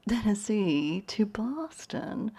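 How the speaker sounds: slow, near-whispered mock disbelief; soft, drawn-out imitation of condescension; hushed polite question. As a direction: breathe it out slowly, imitating polite bafflement. Voices condescending Bostonians' question.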